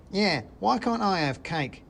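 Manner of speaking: high squeaky complaint; a tiny soldier feeling left out; whining mouse soldier. Acted. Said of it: "said the soldier".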